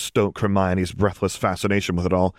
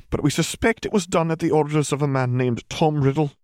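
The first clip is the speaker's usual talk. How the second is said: higher-pitched witch giving a careful account; McGonagall, sober briefing; 6 semitones higher than usual. A narrator's reading. Witch briefing parents on the Ministry, sober and formal. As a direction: report suspicions in a formal voice.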